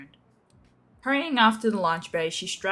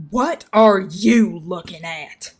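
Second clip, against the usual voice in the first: much louder, slow angry snap; snapping; 12 dB louder, much slower than usual. A narrator's reading. Feather snapping at the two newcomers, angry. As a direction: bark it at the onlookers. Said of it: "Feather snapped to the other two".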